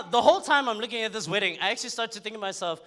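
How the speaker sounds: ordinary talk, nothing marked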